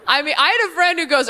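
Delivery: usual speaking voice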